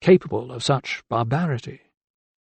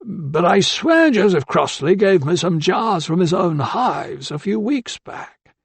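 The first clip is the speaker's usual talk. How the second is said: put-on high, rising, slowing old man's protest; insistent old man; 7 semitones higher, slower than usual. A narrator's reading. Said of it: "more insistent".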